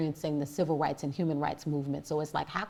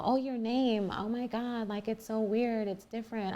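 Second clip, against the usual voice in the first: higher-pitched mimicry of awkward reactions; put-on higher voice, mildly incredulous; mock astonishment; 4 semitones higher than usual. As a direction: imitate someone gushing awkwardly over an odd name. Mimicking people's remarks on her name.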